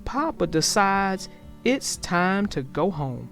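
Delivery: plain reading voice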